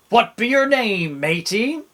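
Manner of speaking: storybook pirate question; pirate asking. A narrator's reading. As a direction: ask like a curious pirate captain. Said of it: "the head pirate asked".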